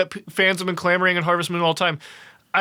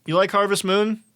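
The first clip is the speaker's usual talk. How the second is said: normal talking voice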